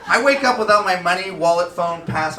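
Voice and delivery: usual speaking voice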